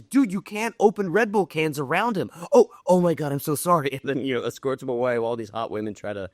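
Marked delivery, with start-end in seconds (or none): 0.0-2.3 alarmed warning. Retold or imitated. Escort scolds man in acted skit. 2.5-3.9 flustered apology. Retold or imitated. Acted flustered apology in skit.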